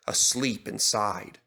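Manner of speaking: ordinary delivery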